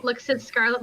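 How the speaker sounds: ordinary talk, nothing marked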